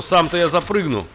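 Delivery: ordinary talk, nothing marked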